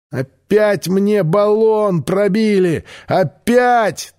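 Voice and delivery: high, slow outraged wail; exasperated yell. Acted. Чак орёт, что ему снова пробили баллон. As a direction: howl the complaint in frustration. Pilot yells about punctured balloon.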